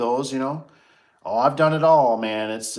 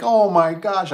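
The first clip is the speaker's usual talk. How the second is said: loud, high cry of alarm; shocked gasp; 9 semitones higher, 9 dB louder, slower than usual. Retold or imitated. Man alone in porta john cries out in dismay. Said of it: "I was like".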